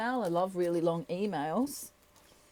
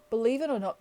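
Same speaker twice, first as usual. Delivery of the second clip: normal talking voice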